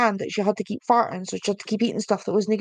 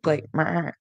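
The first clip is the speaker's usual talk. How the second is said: low bleating "meh" like a sheep; mock sheep bleat; 6 semitones lower than usual. Shown as a demonstration. Streamer shows viewers the Yorkshire sheep noise, a low bleat. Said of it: "do you just actually ba like a sheep".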